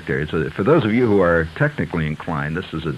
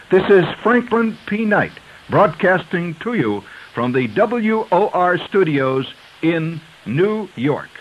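high, very formal, crisply articulated announcer voice; over-enunciate like a 1920s radio announcer; old radio announcer; 10 semitones higher than usual. Shown as a demonstration. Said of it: "I'm almost falling into that pattern of speech".

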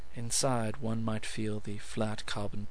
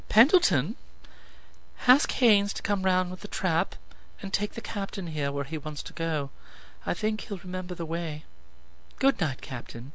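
higher, crisp command to the butler; Lilith, cool dismissal; 8 semitones higher than usual. Acted. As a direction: send him off with icy courtesy. Lilith dismisses Lance via butler.

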